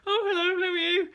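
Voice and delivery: very high, loud mock squeal; imitating friend's greeting. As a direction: squeal a hello as an over-excited friend. Friend's excited greeting, retold.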